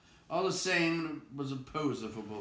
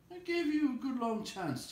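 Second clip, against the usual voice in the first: higher, nervous protest; Bilbo protesting; 4 dB louder than usual. Acted. Bilbo to Gollum, nervously protesting. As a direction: plead your case fast.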